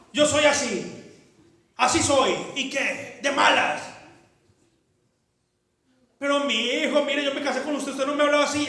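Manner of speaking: loud, high impassioned sermon voice; full-force preaching. Acted. Pastor rebuking the congregation, loud impassioned preaching. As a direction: drive it home with fire.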